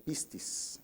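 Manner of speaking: "pistis" with the accent on its first syllable, PIS-tis; stress on the first I of "pistis". Shown as a demonstration. Preacher showing the congregation the Greek word, "pistis" stressed on the first I. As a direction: lean on the first I in "pistis", softly. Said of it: "con acento en la I".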